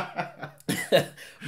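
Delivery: breathy laugh. Real, not acted. Laughter after joke.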